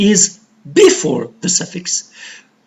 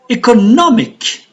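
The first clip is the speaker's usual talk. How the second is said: "economic" with NO swelling high, then dropping; stress on NO; 5 semitones higher than usual. Shown as a demonstration. Teacher demonstrating word stress, "economic" stressed on NO. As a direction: punch the third syllable of "economic", eco-NO-mic. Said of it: "it is just before".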